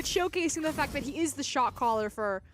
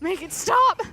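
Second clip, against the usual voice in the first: mock plea; 8 semitones higher, 10 dB louder, slower than usual. Mock plea about emote.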